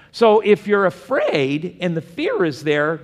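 ordinary talk

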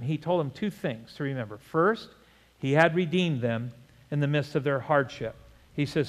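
normal talking voice

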